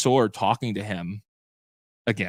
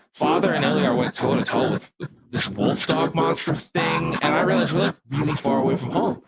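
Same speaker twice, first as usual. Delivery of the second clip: monster voice. Acted. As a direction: growl out the note like a creature, by mistake. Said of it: "I totally did the monster voice".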